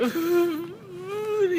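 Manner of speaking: high drawn-out mock sob; crying wail. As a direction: wail "ooh" high like a broken man. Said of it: "he is crying loudly".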